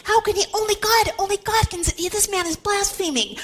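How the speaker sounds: high, indignant protest; scandalized pharisee crying blasphemy, pitched high; outraged. Acted. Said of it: "They were outraged".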